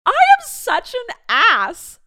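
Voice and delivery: loud, high, slow laughing self-scolding; loud self-mockery. Real, not acted. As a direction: call yourself out loudly through laughter. Laughing at herself.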